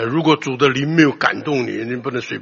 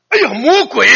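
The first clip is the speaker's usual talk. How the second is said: startled cry; 11 semitones higher, 7 dB louder than usual. Retold or imitated. Song Shangjie slams door on sister.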